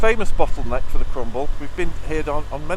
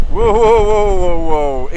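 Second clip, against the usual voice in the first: startled whoa; 3 semitones higher, 9 dB louder than usual. Real, not acted. Rider startled by sudden traffic.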